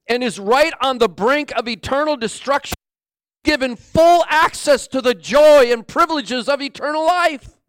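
high, forceful proclamation; impassioned preaching. Real, not acted. Preacher telling listeners there is hope, impassioned. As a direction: drive it home with rising fervour.

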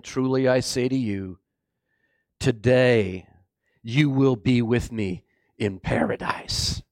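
low, measured, gentle assurance; solemn promise. Acted. Jesus to the dying criminal, solemn assurance. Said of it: "Jesus turned and said to him".

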